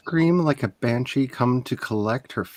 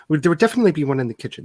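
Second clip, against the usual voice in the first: rushed; much faster than usual. Acted. Hostess babbling rapidly.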